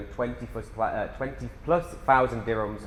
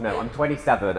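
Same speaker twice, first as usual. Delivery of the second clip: ordinary talk, nothing marked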